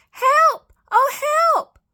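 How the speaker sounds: very high, loud chick cries; squeaked alarm. A narrator's reading. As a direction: squeal for help in panic. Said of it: "he squeaked".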